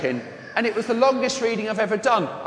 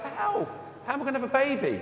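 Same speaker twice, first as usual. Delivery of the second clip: put-on much higher, softer imagined teenage girl; voicing startled Mary; 7 semitones higher, 7 dB quieter, faster than usual. Priest imagining Mary hearing she'll have a baby, high bewildered voice. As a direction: imitate a bewildered girl, pitched right up. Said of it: "she must think to herself".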